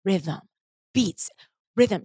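spaced, one word at a time; inner mantra. Retold or imitated. Recalling the word that kept coming through, measured chant. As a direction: leave a beat after each word. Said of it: "the one word that kept coming through".

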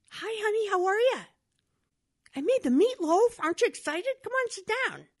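high, eager wife voice; excited wife. Retold or imitated. Wife showing off the meatloaf to her husband, high and eager. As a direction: bubble over with excitement as the wife.

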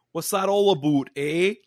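mock Canadian accent with a tagged-on eh; lightly teasing Canadian accent. Poking fun at Canadians, Canadian accent. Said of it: "make fun of Canadians".

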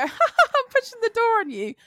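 high, teasing playful voice; playful push. Retold or imitated. Friend teasing her mate while shoving a door, playful high voice. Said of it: "push the door and go".

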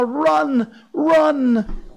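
high, loud cry of alarm; panicked shout. Acted. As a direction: yell it as a terrified monkey. Monkeys flee in panic.

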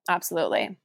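ordinary delivery

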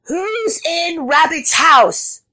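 slow, high-pitched booming call; booming horn voice. A narrator's reading. Frog through a leaf horn, booming. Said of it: "made her voice very loud".

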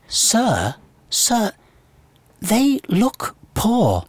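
put-on high, slow posh child voice; drawn-out posh kid's accent; posh schoolchild. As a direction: imitate a very posh little pupil. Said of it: "were very posh".

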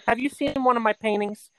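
high, put-on old mother; mom impression. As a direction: act the proud Italian mom. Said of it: "she did the scene with".

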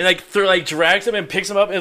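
ordinary talk

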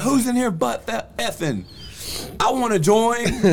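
high-pitched, eager mock call; mock excited shout. Bursting in the door asking to join, mock excited.

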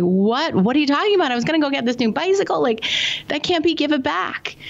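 put-on high-pitched protest of an upset boy, no real sobs; mock-distraught kid pleading with mom; bawling child, imitated. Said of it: "He just starts bawling".

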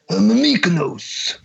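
slow, lightly put-on Greek accent; a hint of a Michael Scott Greek voice. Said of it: "do your Michael Scott".

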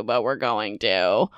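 ordinary delivery

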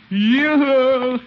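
loud sing-song yoo-hoo across the yard; cheery long-distance call. Acted. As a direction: hail the neighbor from a distance. Calling out to neighbor across yard.

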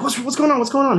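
high, urgent mock worry; alarmed wife. Wife asking her rushing husband what happened, alarmed. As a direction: act out a worried wife pressing for answers.

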